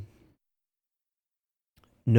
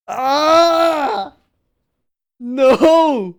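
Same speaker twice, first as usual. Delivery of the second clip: very high, loud shrieks; fan shriek; 8 dB louder than usual. Real, not acted. Viewer shrieking at a shocking anime moment, very high and loud. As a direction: squeal at the screen in alarm.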